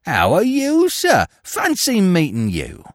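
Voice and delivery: put-on cheerfulness; feigned joy. A narrator's reading. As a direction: caught thief overdoing his pleasure at the meeting. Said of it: "he exclaimed with feigned joy".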